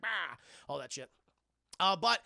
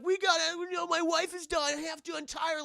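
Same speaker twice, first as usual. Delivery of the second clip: high, loud mock panic; high panicked husband; 11 semitones higher, 5 dB louder than usual. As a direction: plead high and urgent for the wife.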